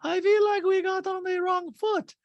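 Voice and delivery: high put-on haughty Diana voice; Diana impression. Recapping Housewives, impersonating Diana.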